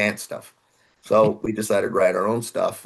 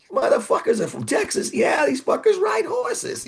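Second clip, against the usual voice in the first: put-on thick New York accent, pitched way up and shouted. Label guy amazed the band is from Texas, mock New York shout. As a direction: imitate a brash New Yorker yelling in disbelief. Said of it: "He has a real thick New York accent".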